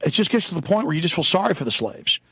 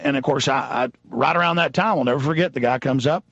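ordinary delivery

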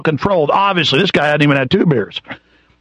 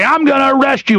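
threatening cop; 9 semitones higher than usual. Retold or imitated. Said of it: "the cops run over and say".

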